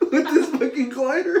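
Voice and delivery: laughing through the words, high; can't hold the laugh in. Real, not acted. Telling a friend the gory bit, laughing. Laughing while retelling gore.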